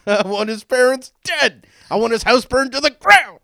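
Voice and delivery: high, loud hammed-up villain; mock villain rant. Joking about the Untouchables scene, hammed-up villain. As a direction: ham it up like a cartoon baddie.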